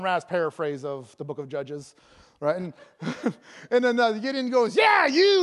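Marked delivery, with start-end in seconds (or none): 4.8-5.4 high, loud hammed-up retort; insistent shout. Angel answers doubting Gideon, loud comic insistence. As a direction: fire it back emphatically.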